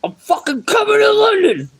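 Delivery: hammed-up howling movie quote; yelled movie quote. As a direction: roar the line like the enraged gangster. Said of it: "That movie Snatch".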